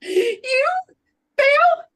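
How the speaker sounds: shrill disbelieving cry, very high; incredulous. Acted. Incredulous at failing.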